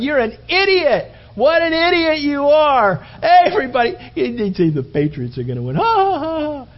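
high, loud scornful taunting with laughter; jeering mockery. Fans ridicule the guy who says the Patriots will win, loud jeering. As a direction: point and laugh at the idiot. Crowd mocks the believer.

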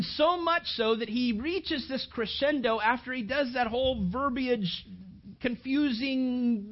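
normal talking voice

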